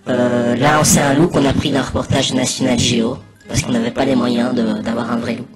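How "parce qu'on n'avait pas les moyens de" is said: ordinary delivery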